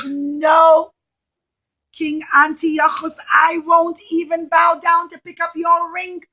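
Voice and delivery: put-on loud defiant child; brave little boy. Little boy defying the king, loud and bold. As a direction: stand firm and call him out.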